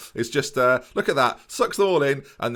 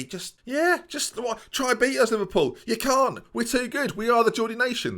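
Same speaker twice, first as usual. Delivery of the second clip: higher, cocky goading of the rival; mock taunt; 10 semitones higher than usual. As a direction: jeer at Liverpool like a smug fan. Taunting Liverpool as Newcastle fan.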